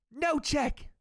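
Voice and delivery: put-on high, slow Irish accent; Irish Conor quote. Streamer reciting Conor's line, Irish accent. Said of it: "I know all the quotes".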